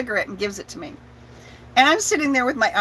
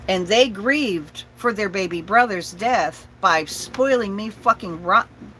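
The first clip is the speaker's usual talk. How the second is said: ordinary talk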